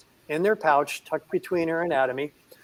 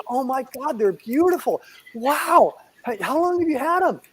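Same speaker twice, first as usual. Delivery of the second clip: higher amazed gushing; amazed delight; 9 semitones higher than usual. Retold or imitated. Man behind the seat marvelling at the gliders, high and amazed. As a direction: marvel at something cute.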